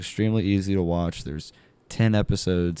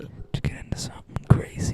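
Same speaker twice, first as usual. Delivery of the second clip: whispering; faster than usual. Pretend. Whispered gossip question.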